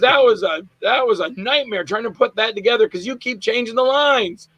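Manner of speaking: high-pitched mock complaint; imitated exasperation. Director scolds actor by phone.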